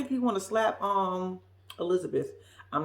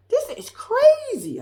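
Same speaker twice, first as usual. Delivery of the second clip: mock outraged boyfriend; 14 semitones higher, 4 dB louder, slower than usual. Voicing Derek, exasperated.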